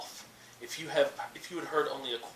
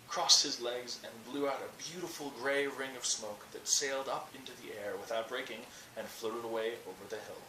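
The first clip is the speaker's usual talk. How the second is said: ordinary delivery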